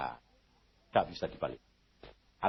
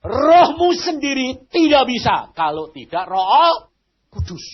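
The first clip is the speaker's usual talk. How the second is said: tearful preaching; 12 semitones higher, 6 dB louder, slower than usual. Real, not acted. Preacher's emotional climax.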